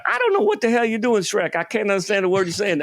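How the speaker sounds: high-pitched, baffled talking donkey; Eddie Murphy as Donkey. Retold or imitated. Talking donkey baffled by the ogre's accent, high exasperated impression. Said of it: "I got to do Eddie Murphy".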